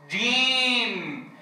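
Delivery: جيم drawn out very slow; teacher modelling a long vowel; held letter name. Shown as a demonstration. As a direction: stretch جيم. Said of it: "اجعل الطالب يتقنه".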